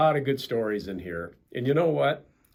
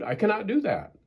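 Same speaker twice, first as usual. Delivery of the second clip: upset Toad; 10 semitones higher, 4 dB louder than usual. Acted. Toad refusing Frog.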